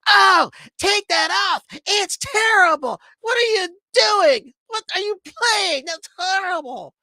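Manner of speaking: high, loud mock yell of an upset lady; mock angry yelling. Upset lady yelling at the record seller to stop the song, hammed-up high outrage. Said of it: "she started yelling at me and says".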